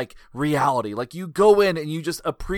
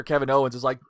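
ordinary talk

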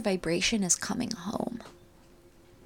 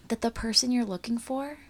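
usual speaking voice

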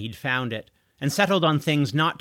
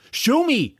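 put-on high, louder insistent teen; pushy demand; 10 semitones higher, 5 dB louder than usual. A narrator's reading. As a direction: demand it with force, pitched up. Teen grabbing George, demanding.

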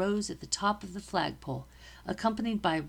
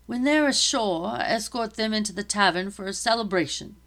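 captain said loudly; 3 semitones higher, 7 dB louder than usual. Acted. Captain ordering his soldiers on the dock, loud. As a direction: project the order to the men. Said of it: "the captain said loudly".

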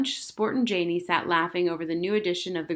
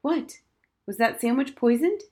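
higher and louder startled questions; alarmed surprise; 6 semitones higher, 4 dB louder than usual. Acted. Friend startled by a grim confession, higher and louder. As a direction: jump at the news.

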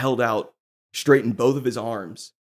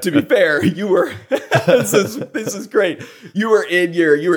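words broken up by real laughter; laughing; 7 semitones higher, 5 dB louder than usual. Real, not acted. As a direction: lose it mid-sentence, laughing. Cracking up at the story.

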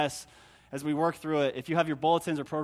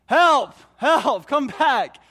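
loud, high desperate calls; yelling for help; 8 semitones higher, 9 dB louder, much slower than usual. Retold or imitated. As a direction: shout for help down the trail. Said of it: "I have to yell".

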